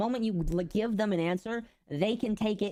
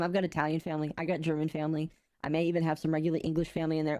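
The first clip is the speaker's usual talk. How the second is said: ordinary delivery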